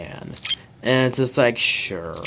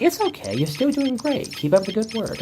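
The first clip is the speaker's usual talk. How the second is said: much higher, sugary southern mother voice, easing down in pitch; sweet examiner encouraging; 13 semitones higher than usual. Retold or imitated. Driving examiner reassures a test taker after a mistake, high sweet voice. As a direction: coo it like a kindly motherly examiner. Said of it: "And she's like".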